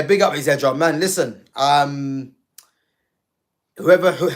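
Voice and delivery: ordinary talk, nothing marked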